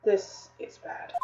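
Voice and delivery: soft, slow whisper; hushed, stunned whisper; whispered dread. Acted. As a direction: breathe it out in shock. Whispered fear after confession.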